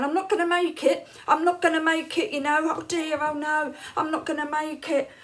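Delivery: hammed-up desperate moan; panicky wail. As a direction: wail that you won't make it in time. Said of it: "there's me going".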